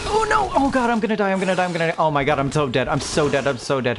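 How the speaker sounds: real panic as the wolves close in; panicked. Real, not acted. Gamer cornered by two wolves, panicked yells.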